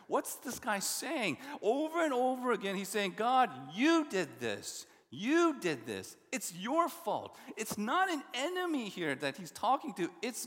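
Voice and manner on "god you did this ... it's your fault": accusing. Acted. Preacher voicing psalmist's accusation.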